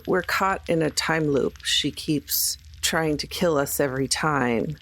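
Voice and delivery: normal talking voice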